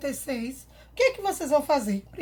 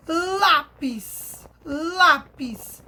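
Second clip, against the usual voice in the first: lápis with a loud, long first syllable; stress on LÁ; 4 dB louder, much slower than usual. Shown as a demonstration. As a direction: hit and stretch the lá in lápis. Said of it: "Sílaba tônica".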